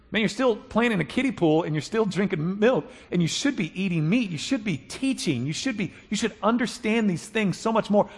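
high-pitched, mildly exasperated scolding; exasperated Paul. Acted. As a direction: plead with grown people to grow up. Voices exasperated Paul scolding immature believers.

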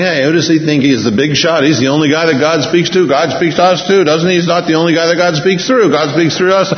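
high, quick mock indignation; indignant complaining. Aaron and Miriam's indignant accusations acted.

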